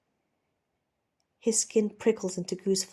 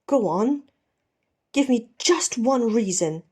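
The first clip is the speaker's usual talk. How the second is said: louder, pressing demand; demanding challenge; 4 semitones higher, 6 dB louder than usual. Acted. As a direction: push him to answer, hard. Ed confronting Steed at knifepoint.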